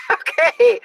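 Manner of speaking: a high laughing okay. Retold or imitated. Imitating a viewer's laughing reply, high and laughing. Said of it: "She said, laughs".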